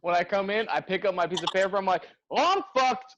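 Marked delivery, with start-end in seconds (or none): 2.3-3.2 high-pitched retold dismay; comic realisation of doom; mock dismay. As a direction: act out seeing a dreadful work list. Reading the day's job list.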